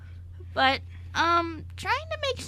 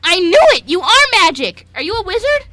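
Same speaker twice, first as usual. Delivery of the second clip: loud, thrilled shout; triumphant loud exclamation; excited discovery; 3 semitones higher, 15 dB louder than usual. Acted. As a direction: burst out with delight. Servant excitedly discovers magic.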